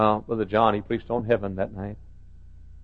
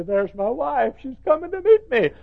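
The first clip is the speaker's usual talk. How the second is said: joyful greeting; 14 semitones higher, 3 dB louder than usual. Retold or imitated. Husband greeting in heaven.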